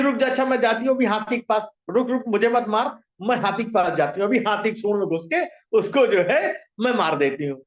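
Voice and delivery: ordinary delivery, nothing marked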